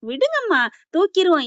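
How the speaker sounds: high-pitched henchman bravado; cocky reassurance. Retold or imitated. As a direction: brush off the boss's worry, pitched high. Henchman assures boss on phone. (தைரியம் சொல்லும் அடியாளின் உச்சக் குரல்)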